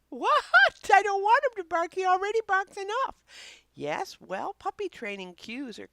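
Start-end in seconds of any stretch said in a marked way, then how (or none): 0.1-3.1 much higher, louder, quick protesting voice; objecting viewer. Pretend. Host voicing a skeptical dog owner, high and protesting. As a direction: squeal in disbelief as a doubtful owner.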